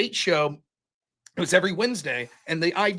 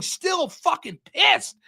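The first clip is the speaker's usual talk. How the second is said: high, very drawn-out exasperation; retold lingering anger; 9 semitones higher, much slower than usual. Loser still fuming on the ride home, slow retold anger. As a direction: stretch each word with pitched-up indignation. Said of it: "That's how mad I was".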